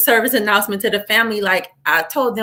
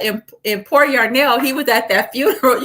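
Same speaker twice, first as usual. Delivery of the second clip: normal talking voice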